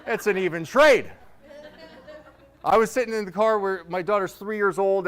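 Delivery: usual speaking voice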